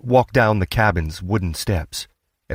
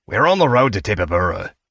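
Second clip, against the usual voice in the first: called out; 2 semitones higher, faster than usual. A narrator's reading. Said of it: "the man called out".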